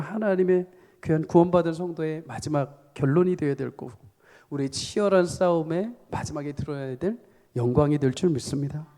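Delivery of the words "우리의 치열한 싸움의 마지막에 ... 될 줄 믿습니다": usual speaking voice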